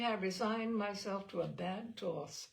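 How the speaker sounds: ordinary delivery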